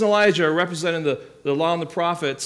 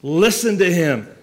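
loud, firm command; Father's voice from heaven; 7 dB louder than usual. Acted. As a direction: quote it as a forceful divine command. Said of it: "the same voice adds the words".

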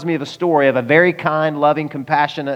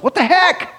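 very high squeaky bird cry of outrage; indignant bird. Acted. The bird's new tree gets shaken too, a very high outraged squeak. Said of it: "the bird says".

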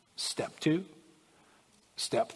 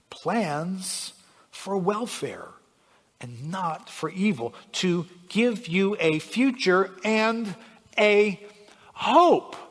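scripture reading; 4 semitones higher, much slower than usual. Acted. Preacher reading God's plans for welfare, slow and building. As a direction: spell out the promise, lifting onto HOPE. Reads the verse aloud.

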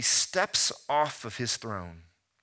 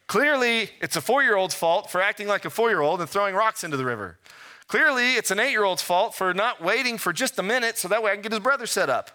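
mock blame; 7 semitones higher, 4 dB louder than usual. Preacher mock-blaming others, rising.

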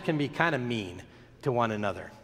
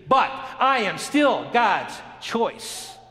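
higher and slower, claiming it boldly; slow, raised declaration of worth; defiant affirmation; 6 semitones higher, 3 dB louder, much slower than usual. Acted. Preacher voicing a believer who answers trials, slow and defiant.

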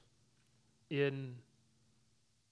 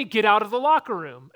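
louder mock yell of get out; retold teacher's yell; 7 semitones higher, 15 dB louder than usual. To the boy at the door, a louder mock teacher's yell. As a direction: replay the teacher's shout across the gym. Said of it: "she's yelling at me".

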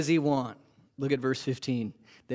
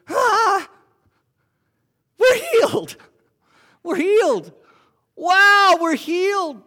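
high, loud, joyful shouts of disbelief; ecstatic healed lepers; 8 dB louder, slower than usual. Acted. Ten leper friends freaking out over being healed, shouting high. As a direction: scream it like you can't believe it.

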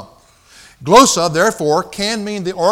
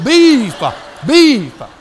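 higher, loud one-word ordering; loud broken tourist speech; 5 semitones higher, 8 dB louder, slower than usual. Retold or imitated. To the waiter, ordering beef in loud halting words. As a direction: spell it out loudly for a foreigner. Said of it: "I was going".

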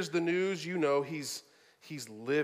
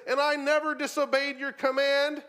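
louder, higher, indignant protest; indignant brother; 7 semitones higher, 5 dB louder than usual. Retold or imitated. The older brother protesting to his father, indignant. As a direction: throw his obedience back at him. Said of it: "He says".

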